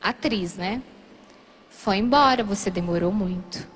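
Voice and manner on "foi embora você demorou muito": lower, straight-faced acted announcement; deadpan serious. Housemate tells her he left, acting very serious. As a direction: play it gravely serious, like an actress. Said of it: "Aí ela bem séria, atriz".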